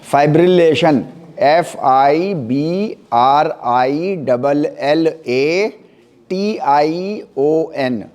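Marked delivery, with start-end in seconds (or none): 1.4-8.1 very slow letter-by-letter spelling; drawn-out letters with small gaps between. Shown as a demonstration. Teacher spells the term again.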